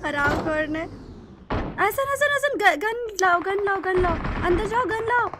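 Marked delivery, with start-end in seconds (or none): 1.8-5.3 loud, raised-pitch frantic calling; real in-game panic, calling out loudly; urgent shouted orders. Real, not acted. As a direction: shout urgent orders to a teammate under attack. Urgently ordering teammate to fetch gun.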